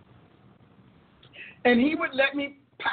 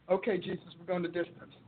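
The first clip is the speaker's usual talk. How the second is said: meek surrender; 5 semitones lower, 9 dB quieter than usual. Retold or imitated. Meek reply to God in story.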